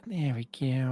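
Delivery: lightly voiced cartoon-kid impression, kept soft; a quick, quiet stab at a cartoon character; Eric Cartman. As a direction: toss off a soft mock cartoon voice. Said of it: "that's my best Eric Cartman impression".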